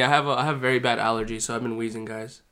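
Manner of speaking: normal talking voice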